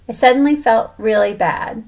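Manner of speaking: ordinary delivery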